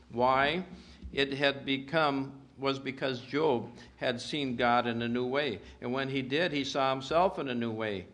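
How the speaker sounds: ordinary delivery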